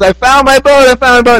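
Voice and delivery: put-on a boy spotting his lost toy, calling it out; excited shout. Boy spots his lost boat in the store window, excited high cry.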